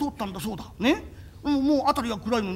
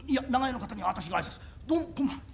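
usual speaking voice

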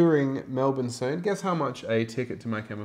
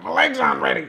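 hatchling monster voice; 8 semitones lower, 8 dB louder, faster than usual. Pretend. Voicing a too-early hatched monster.

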